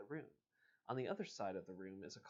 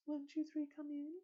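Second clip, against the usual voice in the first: much higher, unaccented. Shown as a demonstration. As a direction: pitch it way up, even and clean. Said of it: "she says in a high, unaccented voice".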